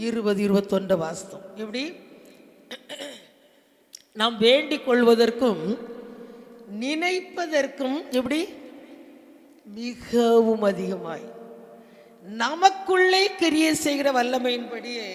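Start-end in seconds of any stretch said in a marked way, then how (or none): 4.2-15.1 loud, measured reading with stretched key words; proclaimed scripture reading. Acted. Preacher leads congregation in reading Ephesians, loudly proclaimed. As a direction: declaim the verse phrase by phrase. Repeats the verse emphatically.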